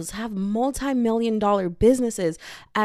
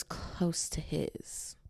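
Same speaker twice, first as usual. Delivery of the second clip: whispered punchline; 4 semitones lower, 12 dB quieter, much slower than usual. Acted. Punchline dropped low.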